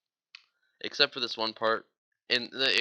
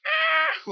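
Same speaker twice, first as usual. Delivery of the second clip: piercing high mock Chewbacca cry; shrill imitated animal howl; high Wookiee wail; 6 dB louder than usual. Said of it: "he's like".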